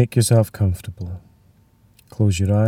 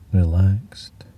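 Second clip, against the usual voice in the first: whispering; 2 semitones lower than usual. Acted. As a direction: breathe it out gently. Single word whispered.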